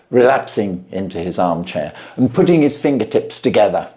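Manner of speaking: ordinary delivery, nothing marked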